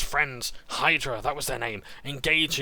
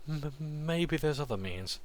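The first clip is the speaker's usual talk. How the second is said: timid stammer; 6 semitones higher, slower than usual. Acted. Boy pleading.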